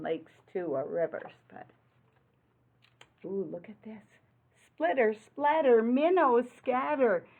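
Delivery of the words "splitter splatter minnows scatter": lively read-aloud rhyme. Acted. Reading rhyming picture book aloud.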